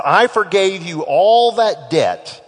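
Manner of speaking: loud, slow, indignant rebuke; angry king. Acted. The king rebukes his servant, loud and indignant.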